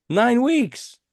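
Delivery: high-pitched, emphatic repeat; amazed emphasis. Real, not acted. Host marvels at nine weeks on the charts, amazed.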